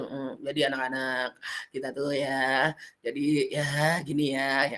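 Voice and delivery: teacher mocking a flat storyteller addressing children; flat dull delivery. Shown as a demonstration. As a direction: drone it with no expression at all. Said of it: "cerita muka jangan datar aja".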